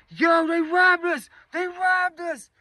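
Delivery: very high, drawn-out mock yell; imitated yelling. Robbed girls alerting the party, imitated high yelling. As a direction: raise the alarm in a high, far-carrying voice. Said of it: "They start yelling".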